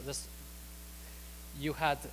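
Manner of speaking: ordinary delivery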